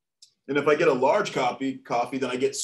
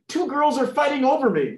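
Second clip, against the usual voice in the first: high, loud mock distress; urgent caller; 10 semitones higher, 5 dB louder than usual. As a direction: report it like an alarmed caller. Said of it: "The man".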